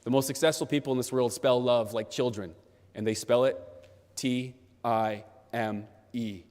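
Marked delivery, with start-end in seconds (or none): 4.2-6.4 slow, separated letters; spelling it out. Real, not acted. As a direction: spell each letter deliberately, with space between. Spells out the key word.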